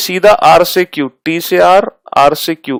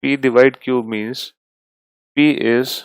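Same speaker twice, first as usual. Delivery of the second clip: slow, quieter dictation with long pauses; 3 semitones lower, 5 dB quieter, slower than usual. Shown as a demonstration. Teacher dictates new symbol rules slowly for the class to note. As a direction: dictate each rule slowly for students to copy.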